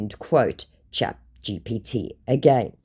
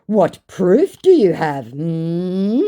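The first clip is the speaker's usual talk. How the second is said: mock smug scammer; 5 semitones higher, 6 dB louder, much slower than usual. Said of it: "To which he says".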